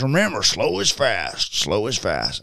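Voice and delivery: imitated Southern drawl, unhurried; thick Southern accent. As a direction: coach it in a broad Southern twang. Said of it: "it's this very thick Southern accent".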